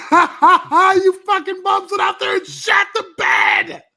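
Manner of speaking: high, slow, laughing yell; laughing shout. Real, not acted. Laughing outburst.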